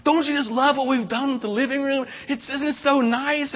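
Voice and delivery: high-pitched, gushing imitation of a wife; mock-excited squeal of delight; great excitement. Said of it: "with great excitement".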